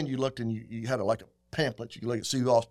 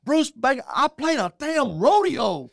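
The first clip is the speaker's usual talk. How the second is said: [name], excited; 11 semitones higher, 7 dB louder than usual. Retold or imitated. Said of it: "he goes".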